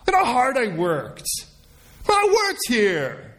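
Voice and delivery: high, drawn-out whining protest; indignant Cain. Acted. Cain protests that his hard work was rejected, exasperated. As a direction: wail the complaint, stretching the words. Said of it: "you're sounding just like Cain".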